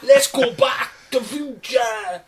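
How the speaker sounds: high, slow, drawn-out daft voice; excited film dreamer. Acted. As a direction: play a simpleton retelling his favourite movie. Film dreamer narrates his story.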